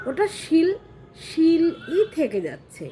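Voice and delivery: usual speaking voice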